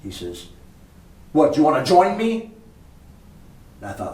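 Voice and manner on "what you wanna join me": imitating a heavy Jamaican accent, much higher and louder; cheeky Jamaican accent invitation. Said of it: "says to him with a heavy Jamaican accent".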